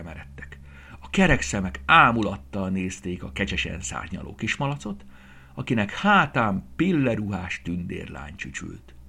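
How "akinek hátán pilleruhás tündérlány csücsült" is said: ordinary delivery, nothing marked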